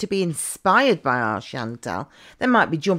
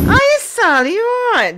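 much higher and louder, sing-song hello; high cheery greeting; 6 dB louder than usual. Acted. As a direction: call out cheerily to the woman on screen. Greeting woman on screen.

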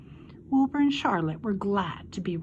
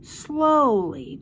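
plain reading voice

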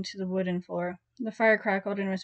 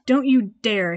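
sharp hiss, louder; a hissed threat, louder; 10 dB louder, slower than usual. A narrator's reading. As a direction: hiss a warning. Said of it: "he hissed".

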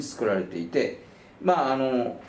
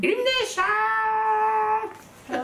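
long, very high sung-out call of the studio name; Illumination jingle; much slower than usual. Retold or imitated. Imitating the Toho movie news Illumination jingle. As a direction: stretch it like a cheery logo jingle. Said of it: "東宝のムービーニュースの".

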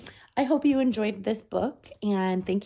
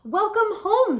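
warm welcome; 10 semitones higher, 6 dB louder than usual. Acted. Mister Buddy greets Max.

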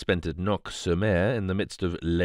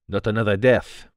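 put-on louder, stressed accented dismay; breathed dismay; 2 semitones higher, 8 dB louder than usual. A narrator's reading. Poirot hears of another death, breathing his dismay. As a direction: react aghast in Poirot's foreign accent. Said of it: "he breathed".

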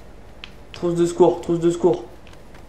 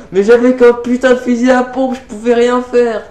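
frustrated outburst; 7 semitones higher, 4 dB louder than usual. Real, not acted. Complaining after dying.

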